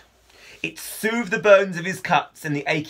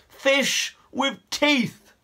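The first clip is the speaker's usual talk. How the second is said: frightened; 10 semitones higher, much slower than usual. Acted. Fred answers in panic.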